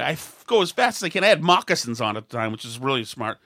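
normal talking voice

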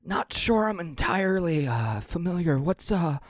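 put-on high, thin old-man voice, unsure and slow; a kindly, confused old passenger; old man Michael.

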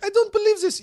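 high, loud disbelieving Russian voice; a high mock Russian cry of disbelief. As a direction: refuse to believe it. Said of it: "She's like".

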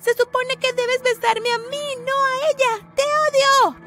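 jealous screaming that builds to a shriek. A narrator's reading. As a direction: scream it at him, jealous and hurt. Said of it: "le grité".

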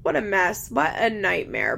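ordinary delivery

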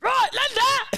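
high, slow shouted Alfie "Right, Linda!"; Alfie impression. Trying the Alfie voice for a friend, slow high shout. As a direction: bellow it like the Peaky Blinders gangster. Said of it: "little bit Alfie".

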